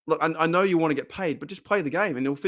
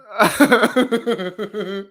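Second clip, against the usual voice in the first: a loud higher laugh; 8 semitones higher, 5 dB louder than usual. Real, not acted. Streamer laughing at a chat comment, loud.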